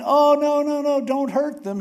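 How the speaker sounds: high, loud plea, half-acted; a retold Moses crying out for mercy; mock pleading Moses. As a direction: beg him to spare them, hammed-up.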